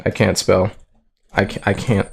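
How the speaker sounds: ordinary talk, nothing marked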